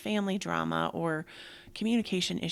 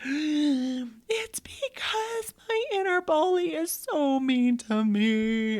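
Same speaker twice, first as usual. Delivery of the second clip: high, slow, louder mock wail; imitating crying daughter; 8 semitones higher, 7 dB louder, much slower than usual. Mother imitating sobbing teenage daughter, high wailing. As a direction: act out her sobbing words. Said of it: "she was like".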